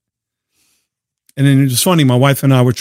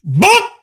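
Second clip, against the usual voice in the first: horn sound; 8 semitones higher than usual. Pretend. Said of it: "give that dude a horn".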